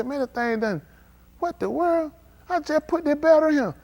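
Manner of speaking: high disbelieving "what the world?"; baffled outburst. Retold or imitated. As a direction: sound stunned that it died. Driver finding dead battery.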